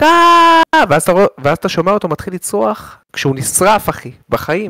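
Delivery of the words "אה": loud high mock scream; retold scream of a burning man; imitated scream. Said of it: "והוא צועק".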